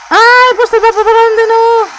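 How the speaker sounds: high, loud startled cry of annoyance; sudden high shout of frustration; frustrated yelp. Real, not acted. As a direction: yelp at the mistake. Frustrated shout at wrong map.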